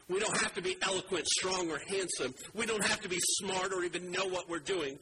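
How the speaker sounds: rising sermon fervour; full-force preaching. Acted. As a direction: preach it out to the congregation.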